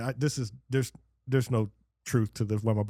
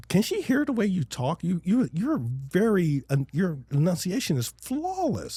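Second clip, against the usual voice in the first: retold indignation; 6 semitones higher than usual. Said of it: "and I'm like".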